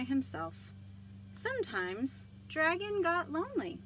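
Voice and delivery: lively storybook read-aloud with voiced characters; reading to children. Librarian reading Dragon aloud to children, animated.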